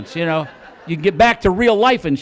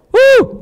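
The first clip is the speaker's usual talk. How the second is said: loud, high hooted whistle; imitated whistle; 11 dB louder than usual. Falconer signalling the hawk, loud whistle imitation. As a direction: whoop out a piercing whistle call. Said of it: "You blow the whistle".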